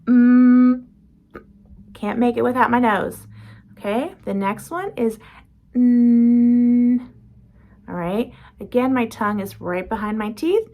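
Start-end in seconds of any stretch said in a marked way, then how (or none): 0.0-0.9 loud "Mm" hum that gets cut off; plugged-nose m. Shown as a demonstration. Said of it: "if I plug my nose, the air stops". 5.7-7.1 long, loud held "n" hum; nasal n. Shown as a demonstration. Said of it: "air is now coming out of my nose".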